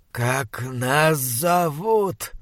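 slow, hushed, rotting monster voice. Acted. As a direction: drag each word like a dying beast. Monster speaking slowly to captive.

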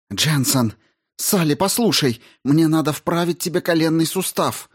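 high, pressing call to a convulsing patient; urgent appeal. Acted. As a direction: get through to her before it's too late. Rao calls to convulsing patient. (настойчиво, встревоженно, высоким голосом)

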